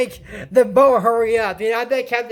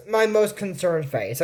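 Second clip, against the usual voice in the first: mock concern; 4 semitones lower, 5 dB quieter than usual. Shown as a demonstration. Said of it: "let me show you the face".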